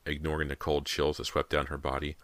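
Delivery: ordinary delivery, nothing marked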